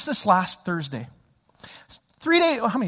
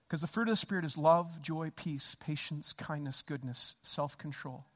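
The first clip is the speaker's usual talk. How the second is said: very soft, low listing; hushed reflection; 5 semitones lower, 14 dB quieter than usual. Real, not acted. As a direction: drop to a near-murmur, item by item. Rueful listing after the rage story.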